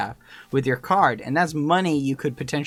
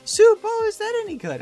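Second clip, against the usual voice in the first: imitated chatty cashier; 8 semitones higher than usual. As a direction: mimic a meddling cashier, pitched way up. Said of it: "She's like".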